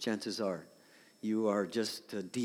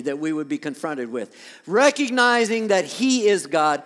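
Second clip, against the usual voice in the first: louder, higher preaching from the pulpit; full-force preaching; 7 semitones higher, 9 dB louder than usual. Acted. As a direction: preach it out to the whole church.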